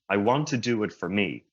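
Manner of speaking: normal talking voice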